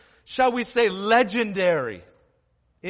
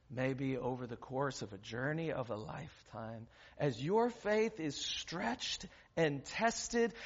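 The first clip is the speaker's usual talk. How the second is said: soft, much quieter, lower sermon voice; quiet reflective preaching; 9 semitones lower, 10 dB quieter than usual. Acted. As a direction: speak gently as if to each listener. Long soft reflective sermon close.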